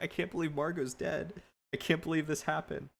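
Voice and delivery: quiet disbelief. Retold or imitated. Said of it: "quieter and not talking as much".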